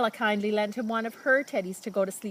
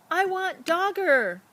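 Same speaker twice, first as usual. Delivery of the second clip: put-on small sad child; 10 semitones higher, 3 dB louder, slower than usual. Sad little boy at bedtime.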